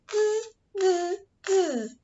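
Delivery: high buzzing monkey sound; monkey chatter. Acted. Monkeys mocking the peddler, high teasing buzz. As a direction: buzz it back like a cheeky monkey. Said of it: "the monkeys ... said".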